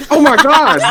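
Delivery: high burst of laughter; laughing shriek. Real, not acted. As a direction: shriek then crack up laughing. Reacting to eyes reveal.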